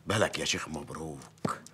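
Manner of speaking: secretive whisper. Acted. Mayor confiding to the sheikh in a whisper. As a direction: whisper it so nobody hears.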